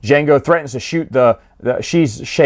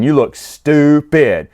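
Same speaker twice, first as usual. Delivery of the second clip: slow, stretched-out mock scorn; taunting father, hammed-up and slow; mocking insult; slower than usual. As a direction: drag out the insult syllable by syllable. Acts out father's insulting recorded message.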